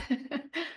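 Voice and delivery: short high breathy laugh; a light embarrassed chuckle. Real, not acted. Guest laughing at her host's praise, light laugh.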